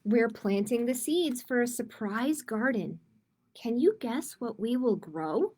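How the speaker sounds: put-on gentle storybook read-aloud for kids; reading to children. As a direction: read it like storytime for little ones. Reading a picture book aloud.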